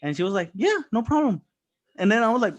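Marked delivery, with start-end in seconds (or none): 0.5-1.4 high, loud quoted voice; imitated cheery agreement. Pharmacy manager agreeing to a test, imitated high and cheery. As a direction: imitate her easy yes, pitched well up.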